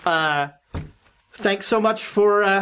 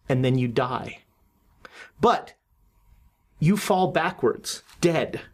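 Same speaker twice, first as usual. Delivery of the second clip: dream narration; 4 semitones lower than usual. Acted.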